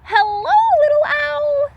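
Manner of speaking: very high, loud piglet squeal; high squeaky piglet voice calling hello. A narrator's reading. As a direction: squeal it like an excited baby pig. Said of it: "squealed another".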